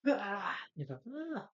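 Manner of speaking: high mock shriek of fright; a sudden scared squeal; startled yelp. Gary frightened by Arthur, high mock yelp.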